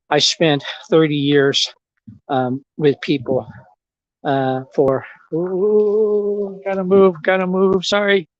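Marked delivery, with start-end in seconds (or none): 5.3-8.3 startled by a sprinkler spray; hurried, caught by cold water. Real, not acted. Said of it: "the sprinkler got me".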